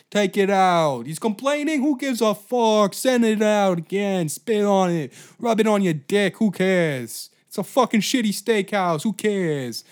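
hammed-up arrogant boss ordering staff; mock boss yelling.

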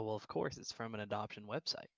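ordinary talk, nothing marked

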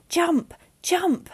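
high, loud urgent shout; repeated desperate call. Acted. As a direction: yell it over the wind. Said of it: "Jess repeated".

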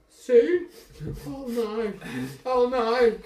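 high, loud, mock-panicked cry; startled high voice of an intimidated man; alarmed. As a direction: call out in hammed-up dismay. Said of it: "looks around and goes".

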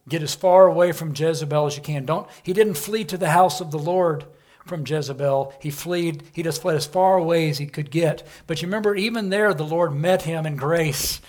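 preacher's swelling declamation; full-force preaching. Acted. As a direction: proclaim it to the back row. Preaching the flight.

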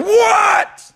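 high, loud, irritated yell; upset shout. Retold or imitated. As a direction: snap back as if furious at being called, hammed up. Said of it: "He's just real upset".

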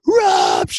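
piercing high howled name. Retold or imitated. The whole city of Lublin howling, very high and loud. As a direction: howl the name across the city. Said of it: "the howl went up".

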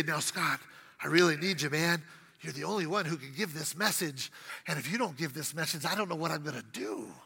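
low, hushed and pleading, lightly voiced; hushed mock plea, rushing at the end; mock pleading God. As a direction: plead quietly like a desperate boss.

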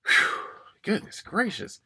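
tired, fading and soft; out of steam. Real, not acted. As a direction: trail off, worn out and dry. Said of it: "because I'm running out of steam".

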